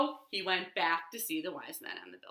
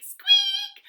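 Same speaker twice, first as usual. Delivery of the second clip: high squeak; 10 dB louder than usual. Pretend. Bed squeak effect.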